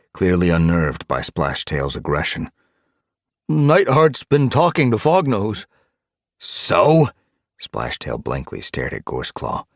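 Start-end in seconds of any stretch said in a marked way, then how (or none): 3.5-5.7 high, loud, nervous voice; raised, anxious pitch; unnerved report. Acted. Underling unnerved by leader's aggression. 6.4-7.1 high, loud one-word challenge; loud, dismissive comeback; aggressive retort. Acted. Aggressive leader dismisses report.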